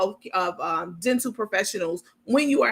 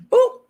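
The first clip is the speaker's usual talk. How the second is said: high boop; 9 dB louder than usual. Acted. Comic sound for a censored bit.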